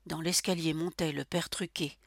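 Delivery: normal talking voice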